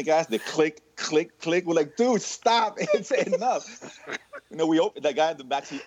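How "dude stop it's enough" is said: laughing plea. Retold or imitated. Begging friend to stop pumping.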